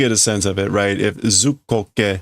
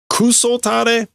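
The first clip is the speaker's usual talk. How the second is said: high-pitched scolding voice; scolding boss; 12 semitones higher, slower than usual. Acted. Boss scolding idle workers, high-pitched scolding.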